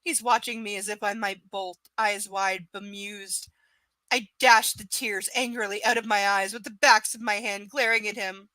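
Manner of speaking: ordinary talk, nothing marked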